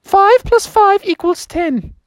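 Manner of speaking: high falsetto little pupil; Molly the muskrat. Acted. Muskrat pupil offers a sum to teacher, high careful voice. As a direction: answer carefully in a high creature voice. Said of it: "they called on Molly the muskrat".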